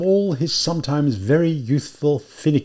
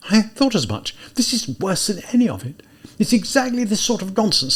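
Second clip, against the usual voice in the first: higher, tense talking to himself; confused, agitated young man; 6 semitones higher than usual. A narrator's reading. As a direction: fret aloud at yourself, voice pitched up. Character's agitated monologue after muttering in confusion.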